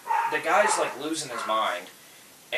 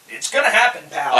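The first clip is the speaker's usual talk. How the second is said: high, loud mock warning; raised-voice showdown warning, played for laughs; retold threat; 6 semitones higher, 13 dB louder than usual. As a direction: call it out as a comic threat. Acts out threat to kick him.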